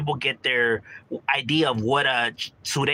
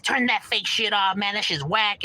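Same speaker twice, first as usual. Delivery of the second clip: higher-pitched, mildly annoyed complaint; dismissive homies; 6 semitones higher than usual. Retold or imitated. Homies telling him to cut the Chicano rap, dismissive. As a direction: act out homies rejecting the music. Homies protesting a played song.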